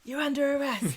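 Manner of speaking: lightly acted, teasing arrest; imitating Craig. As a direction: playfully break the bad news. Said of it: "who's like".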